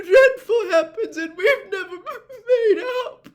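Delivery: high, loud, stammering through sobs; sobbing outpour. A narrator's reading. Missus Weasley lists her dread for the family, sobbing. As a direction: pour out every fear through tears.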